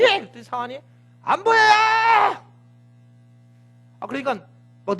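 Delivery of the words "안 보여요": servant's shout. Acted.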